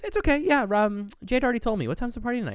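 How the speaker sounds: very high, put-on mother voice; mock prying mom. Mother tricking son, retold.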